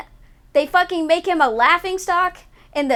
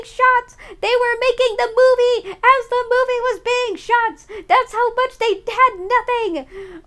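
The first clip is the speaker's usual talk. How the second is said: exasperated rant; 7 semitones higher than usual. Real, not acted. Fan venting to chat about unplanned movies, shrill exasperation. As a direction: pitch climbing as the anger boils over. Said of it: "to vent my angry".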